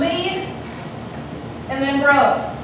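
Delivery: "Lead", a long pause, then "bro"; divided into syllables. Shown as a demonstration. Teacher confirms a student's guess, "Lead" and "bro" split by syllable. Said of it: "How do we divide this word?".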